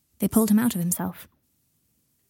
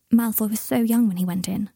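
usual speaking voice

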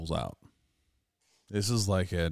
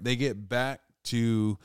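usual speaking voice

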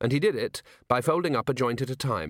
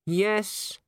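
high, resigned yes; gloomy; 9 semitones higher than usual. A narrator's reading. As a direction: answer with a touch of gloom. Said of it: "said Littlebottom gloomily".

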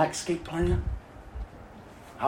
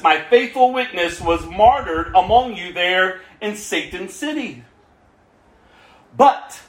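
scripture reading; 7 semitones higher than usual. Acted. Preacher reading Revelation to the congregation, a bold proclaiming read. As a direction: proclaim the text as if the letter were read aloud.

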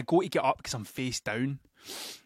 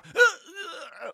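dramatic gasp; 14 semitones higher, 3 dB louder than usual. Retold or imitated. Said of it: "Shakespeare'd it".

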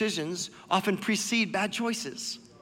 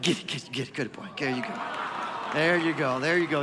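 praising a dog; 7 semitones lower, 4 dB quieter than usual. Real, not acted. Letting dog eat treat.

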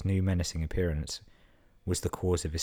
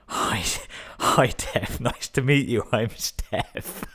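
high, loud, cracking up mid-quote; words bursting out between laughs; laughing while reading; 6 semitones higher, 5 dB louder, much slower than usual. Real, not acted. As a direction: fight through real laughter to finish. Cracking up at the joke's punchline.

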